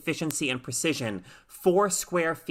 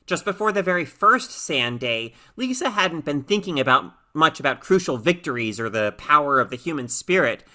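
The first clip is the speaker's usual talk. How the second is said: ordinary delivery, nothing marked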